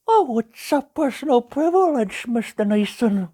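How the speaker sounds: gentle cartoon bear narrating; Pooh Bear voice. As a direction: read it as a gentle honey-loving bear. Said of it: "Pooh Bear, for reading".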